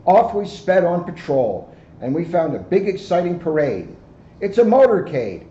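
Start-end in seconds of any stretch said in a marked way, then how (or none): 4.4-5.4 excited announcement. Acted. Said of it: "said Officer Diaz".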